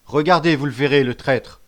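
loud, commanding accusation; accusing call. A narrator's reading. Chief exposing the traitor, loud accusing call. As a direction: call all eyes onto the culprit.

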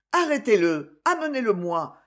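ordinary delivery

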